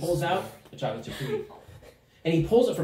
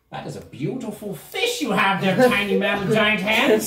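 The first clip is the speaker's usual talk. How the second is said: high, gushing gnome praise; admiring gnome; 5 semitones higher, 4 dB louder than usual. Acted. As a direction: build to a loud, showy compliment.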